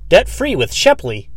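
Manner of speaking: mock billboard pitchman; hammed-up ad voice. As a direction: sell it like a cheesy jingle. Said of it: "It was like".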